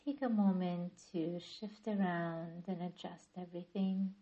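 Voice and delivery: calm yoga nidra guidance with long pauses; guided meditation. Acted. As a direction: ease listeners into stillness, phrase by unhurried phrase. Leads guided relaxation practice.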